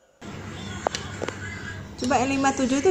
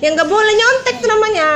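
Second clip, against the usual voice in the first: raised, scolding voice; 11 semitones higher, 6 dB louder than usual. Real, not acted. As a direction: tell her off, loud and quick. Mother scolds child for copying.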